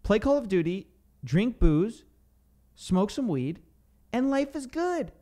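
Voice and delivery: mock the buddy who'd rather sit on the couch; lazy unemployed friend. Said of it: "he goes".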